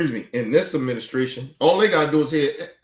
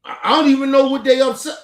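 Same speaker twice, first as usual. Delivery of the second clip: exasperated outburst; 9 semitones higher, 6 dB louder, faster than usual. Real, not acted.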